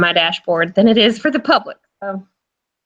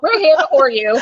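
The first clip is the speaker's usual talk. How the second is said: laughing. Real, not acted. Joking tagline.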